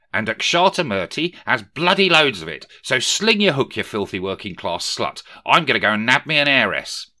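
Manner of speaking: loud, high, brash dismissal; sneering young Rishi. A narrator's reading. Young Rishi dumps Belle for an heiress, loud and brash. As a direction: send her off with a sneer.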